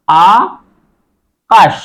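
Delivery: আকাশ said very slowly, split into আ and কাশ; syllables drawn out. Shown as a demonstration. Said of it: "যে এই আকাশ, ... এখানে কয়টা অংশ?". (আকাশ ধীরে ধীরে অক্ষর ভাগ করে)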